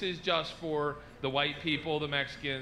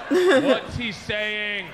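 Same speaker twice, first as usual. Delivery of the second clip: very slow, louder repeat; insistent question; 3 semitones higher, 9 dB louder, much slower than usual. Retold or imitated. Retelling pressing a stranger to translate, slow and loud. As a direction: stretch each word out insistently. Asks stranger a second time.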